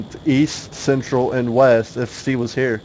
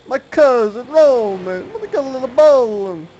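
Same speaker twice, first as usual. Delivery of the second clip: mock accented cousin inviting you bowling, pitched way up; Roman from Grand Theft Auto; 10 semitones higher, 4 dB louder than usual. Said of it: "Grand Theft Auto, uh, Roman".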